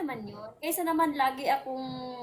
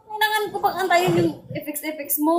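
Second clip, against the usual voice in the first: loud exasperated complaint; naiinis; 3 semitones higher, 7 dB louder than usual. Real, not acted. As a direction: raise your voice, really fed up. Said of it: "naiinis ako sa'yo". (malakas at inis na reklamo)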